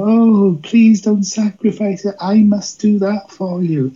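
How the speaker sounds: high, overwrought mock pleading; cheap melodrama parody. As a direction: plead like a tacky TV heroine, mockingly. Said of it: "like I was watching one of these cheap, tacky programs".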